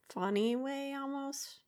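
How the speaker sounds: usual speaking voice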